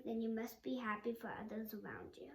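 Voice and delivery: ordinary delivery, nothing marked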